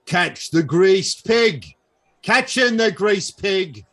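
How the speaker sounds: high, loud carnival call; barker shouting. Acted. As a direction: bellow it across the fairground. Bugbear barker calling crowd.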